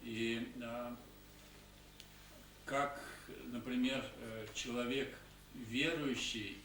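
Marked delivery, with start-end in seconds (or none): none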